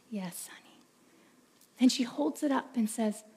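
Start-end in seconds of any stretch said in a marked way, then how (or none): 0.1-0.8 soft whispered answer; father whispering to his tearful daughter. Acted. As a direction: answer in a hushed, tender whisper.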